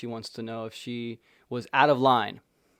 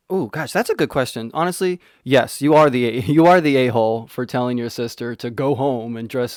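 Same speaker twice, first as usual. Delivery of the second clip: emphatic verdict; 9 dB louder than usual. Real, not acted. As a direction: declare it with a laugh. Excited verdict, loud.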